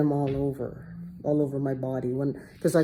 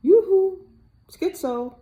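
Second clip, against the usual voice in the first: much higher, louder sing-song yoo-hoo, teasing; taunting voice in my head; 14 semitones higher, 4 dB louder, slower than usual. Retold or imitated. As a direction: call out like a mocking voice in her head. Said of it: "voice in my head".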